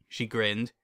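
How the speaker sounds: ordinary delivery, nothing marked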